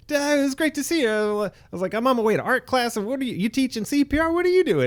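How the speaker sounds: high, fast, sing-song mock cheer; sugary over-the-top friendliness; fake cheerful. Mock cheerful greeting to embarrassed dad.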